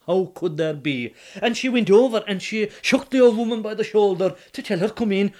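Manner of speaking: ordinary delivery, nothing marked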